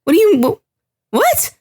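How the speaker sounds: playing Steve thrown completely off guard; baffled disbelief. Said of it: "you see the judgment in his face where he's like".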